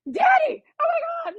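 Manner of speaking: high, loud cry of amazement; high shout of amazement; excited cry. Retold or imitated. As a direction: call out to Daddy in wonder. Crying out seeing the dove.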